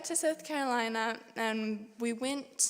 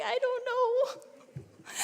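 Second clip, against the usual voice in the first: tearful; 13 semitones higher, 4 dB louder, much slower than usual. Retold or imitated. Crying when the pastor asks why.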